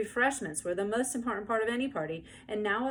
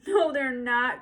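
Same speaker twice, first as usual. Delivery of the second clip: high, loud tearful protest; high crying child; 6 semitones higher, 8 dB louder, slower than usual. A narrator's reading. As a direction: cry back at her. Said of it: "cried Susan".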